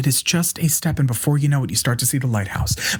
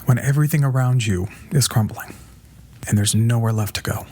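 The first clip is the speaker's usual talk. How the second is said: hushed, breathy motivational opener over music. Acted. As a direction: draw them in gently. Inspirational opening over music.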